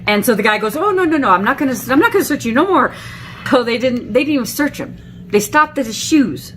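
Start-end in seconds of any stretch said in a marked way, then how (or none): 0.8-2.9 higher, rushed mock refusal; retold hasty refusal. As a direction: imitate a guard backing off in a hurry. Guard refuses to search further.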